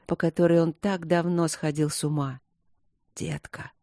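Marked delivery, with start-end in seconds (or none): 3.1-3.7 a rasped, breathless «Детка!», much softer; husband rasps «Детка!» at his wife in desire; hoarse rasp. A narrator's reading. Husband to wife in bed, hoarse and breathless. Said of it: "прохрипел он".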